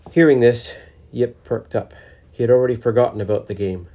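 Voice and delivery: usual speaking voice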